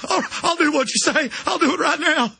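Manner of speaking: a hammed-up groveling gun shop owner; cowardly gun shop owner. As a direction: play the spineless owner eager to please. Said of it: "weak gun shop owners like".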